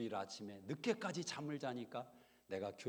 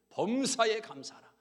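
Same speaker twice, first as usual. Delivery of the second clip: 범사에 감사하라 higher and louder, 범사에 hit hard; proclaimed verse; 9 semitones higher, 10 dB louder than usual. Retold or imitated. Pastor proclaiming the miracle-bringing verse, loud. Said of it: "기적을 불러오는".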